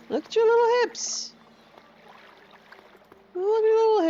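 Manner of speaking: very high cooing over tiny hips. Real, not acted. Cooing at her pet atronach, very high. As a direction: gush at the little creature in a squeaky coo.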